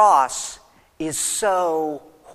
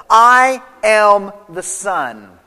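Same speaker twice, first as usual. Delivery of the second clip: very slow, each word set apart; slow simple statement; 5 dB louder, much slower than usual. Shown as a demonstration. Preacher giving Jesus' simple answer, very slow. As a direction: say it slow and plain. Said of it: "his answer is very simple".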